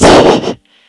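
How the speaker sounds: sharp burst of laughter; loud unvoiced laugh. Real, not acted. Laughing at model.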